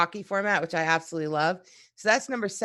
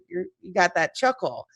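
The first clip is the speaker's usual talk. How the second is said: ordinary delivery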